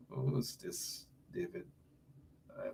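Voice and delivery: near-whispered off-mic aside; hushed aside. Real, not acted. Host mutters to himself about a chat caller, hushed. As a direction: drop to a hush to ask who it is.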